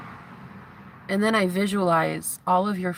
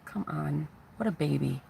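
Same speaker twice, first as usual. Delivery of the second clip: dismissive mock sneer; 2 semitones lower, 7 dB quieter than usual. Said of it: "And you say".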